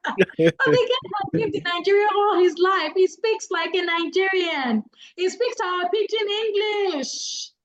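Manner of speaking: high-pitched, amused exclamation; amused protest. Real, not acted. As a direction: push it up high, incredulous after a laugh. Amused protest after laughing at the story.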